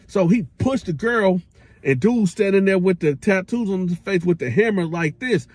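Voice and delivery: normal talking voice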